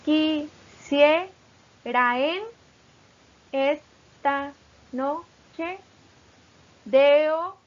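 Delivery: very slow, long gaps between beats; each syllable group in one separate, rising stroke; syllable-by-syllable demo. Shown as a demonstration. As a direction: chop the verse into slow, measured syllables. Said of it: "lectura de un solo golpe".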